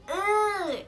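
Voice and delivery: high, loud groan of disgust; teacher grossed out by the mess; disgusted ugh. Retold or imitated. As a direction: mimic the teacher recoiling.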